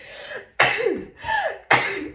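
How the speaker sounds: sudden harsh coughing; coughing fit. Real, not acted. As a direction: cough it out hard. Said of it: "excuse me".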